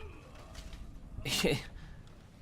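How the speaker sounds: soft high giggling; breathy giggle. Real, not acted. Giggle after scream.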